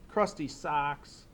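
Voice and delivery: ordinary talk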